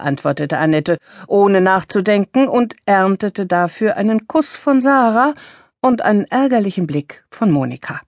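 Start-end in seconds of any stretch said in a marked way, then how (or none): none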